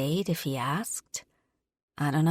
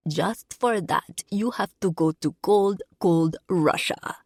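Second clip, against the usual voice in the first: bad Russian accent. A narrator's reading. Woman sending him to Russia in a drinking game, terrible Russian accent. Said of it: "I put on the worst Russian accent anyone has ever heard".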